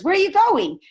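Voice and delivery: high, loud father's challenge; acted demanding shout. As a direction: call out sharply after a running child. Father challenges running son in story.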